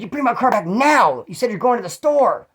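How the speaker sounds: mad mom. Retold or imitated. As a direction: imitate an angry mother demanding the car back. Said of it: "She's mad".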